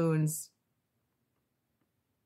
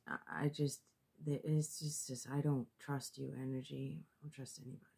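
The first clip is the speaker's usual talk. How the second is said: soft withdrawn muttering sinking toward a whisper; a loner giving up, fading from soft to breathy whisper; withdrawn retreat; 5 semitones lower, 9 dB quieter, faster than usual. Acted. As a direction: pull back into yourself, quieter and quieter. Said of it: "somebody who just decides to, uh, stop dating".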